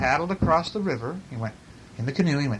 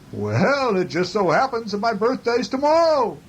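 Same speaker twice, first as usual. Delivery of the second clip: higher-pitched mountain echo voice; 9 semitones higher, 3 dB louder than usual. A narrator's reading. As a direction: send it back as a high echo. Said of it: "The echo came back".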